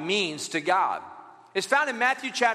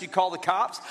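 ordinary talk

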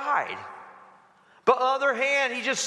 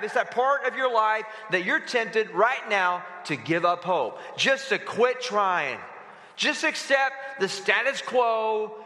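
ordinary talk

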